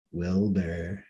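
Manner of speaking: low, soft moaning call; ghostly call. A narrator's reading. Ghost calling from downstairs.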